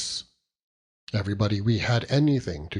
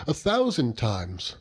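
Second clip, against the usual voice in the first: ordinary delivery, nothing marked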